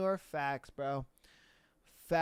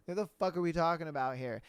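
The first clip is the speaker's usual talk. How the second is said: ordinary talk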